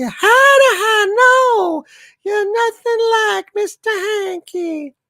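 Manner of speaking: squeaky, very high falsetto cartoon voice; loud, hooting high Southern cartoon character; Mister Hanky. Shown as a demonstration. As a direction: answer in a piercing high cartoon pipe. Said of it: "am I, Mister Hanky?".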